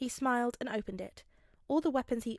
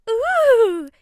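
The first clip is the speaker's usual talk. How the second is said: very high, loud delighted squeal; girlish squeal; 12 semitones higher, 16 dB louder than usual. A narrator's reading. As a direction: squeal with glee. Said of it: "Himiko squealed".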